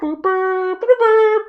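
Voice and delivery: flute toots. Retold or imitated. Imitating Bob's flute before he rises from the ocean, high piping toots. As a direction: toot it out like a little flute summoning Bob.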